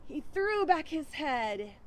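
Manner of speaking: put-on lively read-aloud storytelling for kids; story narration. A narrator's reading. Children's minister reading a farm fable aloud, lively.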